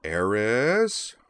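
"Eris?" drawn out, gliding upward; sing-song call. A narrator's reading. Man calls "Eris?" for his hidden friend, sing-song. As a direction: call "Eris?" in a lilting, rising tune. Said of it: "said in a sing-song tone".

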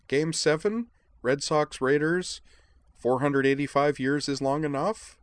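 high, loud, insistent questioning; incredulous. A narrator's reading. Edward explains the big game to Moose, incredulous. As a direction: spell out the obvious in rising disbelief.

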